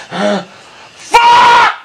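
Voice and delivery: high, drawn-out furious yell; angry shout. Real, not acted. Gamer shouting as he blows an easy shot, furious. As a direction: howl in frustration.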